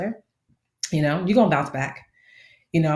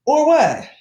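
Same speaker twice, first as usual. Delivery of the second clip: defiant challenge; 9 semitones higher, 6 dB louder than usual. Retold or imitated. Mock standoff with the bank.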